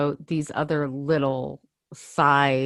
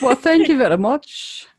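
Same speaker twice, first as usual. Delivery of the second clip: higher-pitched mock-posh British thanks; put-on posh accent; 6 semitones higher, 4 dB louder than usual. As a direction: accept the compliment in a posh accent. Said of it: "that's a lovely accent".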